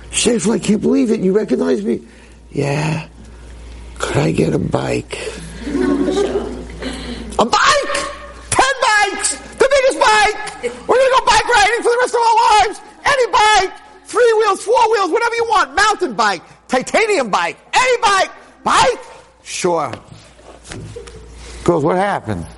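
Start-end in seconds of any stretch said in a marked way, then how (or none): 0.1-2.0 high, tearful joy; overjoyed father. Retold or imitated. Father at the hospital bed, overjoyed and high. Father greets waking son. 2.5-5.2 put-on small slow child's voice; weak little boy. Hurt boy in bed asking his father for a bike, slow and meek. 5.7-19.9 giddy gushing father. Retold or imitated. Father at his son's bedside promising any bike, very high and gushing.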